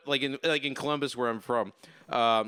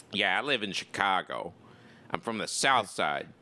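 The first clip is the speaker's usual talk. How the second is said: Chicago accent; 7 semitones lower, 4 dB quieter than usual. Shown as a demonstration. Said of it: "an impression I like to make".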